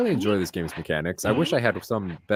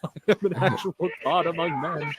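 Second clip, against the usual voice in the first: villain taunt; 8 semitones higher than usual. Pretend. Taunting the opponent in-game.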